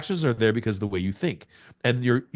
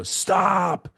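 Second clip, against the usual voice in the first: high, drawn-out cry of stop; retold yell; 9 semitones higher than usual. Child to mom driving off, retold yell. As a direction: call out sharply to halt someone, much higher. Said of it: "and I yell".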